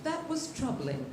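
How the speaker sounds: soft, slow announcer understatement; imitated sports announcer. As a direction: quietly deliver a commentator's grave verdict. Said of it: "favorite three words that announcer has ever said".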